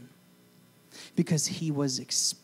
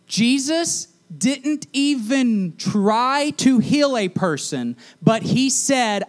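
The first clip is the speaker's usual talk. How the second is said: full-force preaching; 9 semitones higher, 7 dB louder than usual. Real, not acted. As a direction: drive the point home to the room.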